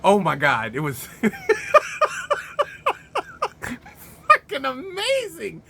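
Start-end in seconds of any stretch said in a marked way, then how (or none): 1.1-5.6 high squealed amazement through laughter; laughing hard. Real, not acted. Reviewer raving about the spinning kick, cracking up. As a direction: laugh first, then squeak the words out.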